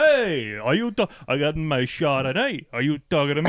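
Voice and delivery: New York tough guy parody; hammed-up New York street challenge; mock New York accent. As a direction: play a touchy New Yorker. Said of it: "this is insulting to New Yorkers".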